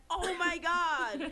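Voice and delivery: high, slow, drawn-out fan gush. Retold or imitated. Now the biggest fan of the rapper, gushing. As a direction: stretch it out in smitten delight. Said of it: "But now it's like".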